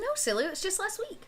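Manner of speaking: a hint of a mother chiding her child fondly; teasing mother.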